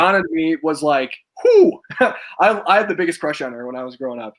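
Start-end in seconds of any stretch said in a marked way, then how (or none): 1.4-1.8 loud, high whoop of attraction; bowled-over whew with a laugh; admiring whew. Real, not acted. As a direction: exhale a big appreciative "whew". Admiring a crush, exclaims.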